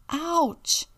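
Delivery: high, loud little yelp; sharp acted child's "ouch"; ouch of pain. A narrator's reading. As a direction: cry out as if bumped. Robbie is bumped in the story.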